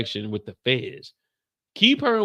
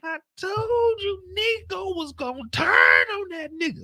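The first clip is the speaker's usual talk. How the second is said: much higher, slow, shouting through tears; Kendall crying out. Retold or imitated. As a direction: wail it like an overwhelmed partner. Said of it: "Kendall said".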